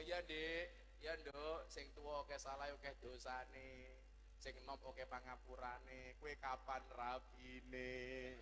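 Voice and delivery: low, slow, sing-song teasing; teasing elder. Retold or imitated. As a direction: draw out the teasing question. Elder sister answering the single. (kakak menggoda, pelan bernyanyi)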